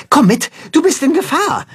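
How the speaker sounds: urgent warning. A narrator's reading. Timme warns Tacho urgently.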